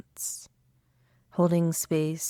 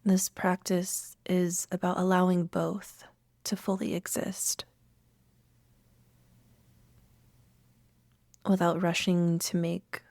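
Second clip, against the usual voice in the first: unhurried meditation guide with long pauses; calm, spacious meditation voice; guided meditation. Acted. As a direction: lead listeners gently inward, leaving room to breathe.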